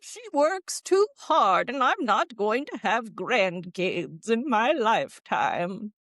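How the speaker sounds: a worried granny complaining; old lady voice. Retold or imitated. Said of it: "I love your old lady voice".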